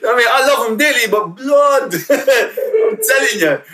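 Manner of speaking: high, loud, laughing rant. Real, not acted. Laughing to the host about his own kids burning him.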